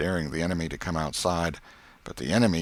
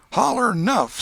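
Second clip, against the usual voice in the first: put-on high, loud boyish yell; boy's demand; 7 dB louder than usual. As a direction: bark it down at the beaten boy. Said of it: "said he".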